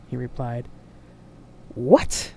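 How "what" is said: loud, outraged one-word bellow. A narrator's reading. Admiral reacts to the woman's claim, bellowing. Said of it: "bellowed the Admiral".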